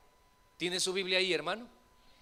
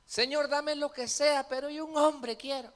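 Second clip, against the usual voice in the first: mock desperate woman; 6 semitones higher, 3 dB louder than usual. Pastor voices a desperate woman.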